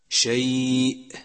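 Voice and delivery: شيء held slowly, ending in a clear glottal stop; clear final hamza. Shown as a demonstration. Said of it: "يجب بيان الهمزة وتحقيقها إن وقف". (وقف واضح على همزة شيء)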